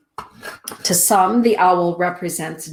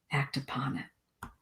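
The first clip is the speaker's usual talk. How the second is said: much softer repeat of the message, almost breathed; hushed echo; 4 semitones lower, 15 dB quieter than usual. Real, not acted. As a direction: murmur it as a private reminder. Echoes the owl's message.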